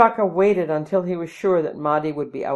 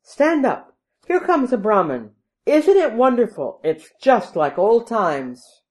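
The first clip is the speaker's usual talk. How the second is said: high, loud, delighted father; excited, raised voice full of gladness; happy calling out; 6 semitones higher, 6 dB louder than usual. A narrator's reading. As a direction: call to your son, bubbling with joy. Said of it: "he called to his son".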